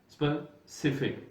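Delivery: स्पेसिफिक slow with a clear "f", "spe-SI-fik"; correct pronunciation. Shown as a demonstration. Teacher to students, slowly modelling the right form. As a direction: model स्पेसिफिक with a true f sound. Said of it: "लेकिन ये स्पेसिफिक नहीं है".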